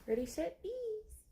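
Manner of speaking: ends on a very high squeak; playful countdown. Acted. Owner starting a chase game with her cat, squealing. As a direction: count down and squeal the last word.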